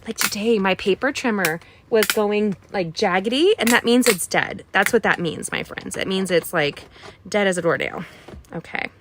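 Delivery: normal talking voice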